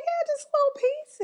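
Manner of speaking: very high sugary little voice; playing herself sweetly agreeing with the boss, very high; sweet fake politeness. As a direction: squeak it out, all polite to the big boss. Speaker voicing herself to boss.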